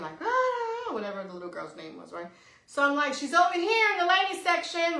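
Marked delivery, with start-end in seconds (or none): 0.2-0.9 high-pitched wordless wail, a mock scream; imitated scream. Retelling a lady screaming for a lost girl, mock scream. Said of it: "hear this lady screaming the little girl's name". 3.1-5.0 raised, high calling voice. Retold or imitated. Shopper calls to the searching lady, calling out.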